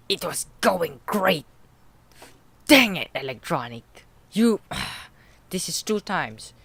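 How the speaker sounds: loud, hammed-up indignation; mock outrage. Player scolding Electronic for interrupting, loud mock outrage. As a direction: rail in mock fury, drawing words out.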